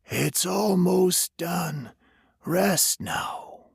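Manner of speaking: a stranger soothing a dying man; eerie comforting stranger. A narrator's reading. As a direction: lull him toward death gently. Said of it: "in a comforting tone".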